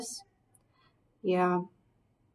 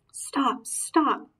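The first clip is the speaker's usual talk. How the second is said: mild mock shout, higher; a read-aloud shout, pitched up; 6 semitones higher than usual. Mom reading Thorin yelling to the dwarves, mild mock shout. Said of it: "shouted Thorin".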